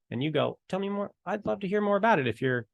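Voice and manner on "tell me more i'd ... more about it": higher, keen listening voice; light and eager, inviting more; mock-eager invitation to keep talking; eager interest. Acting eager listener in scenario.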